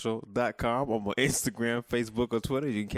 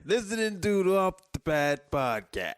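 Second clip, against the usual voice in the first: cheesy host voice; 9 semitones higher than usual. Pretend. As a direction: sing out the welcome like a DJ. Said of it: "playful host intro".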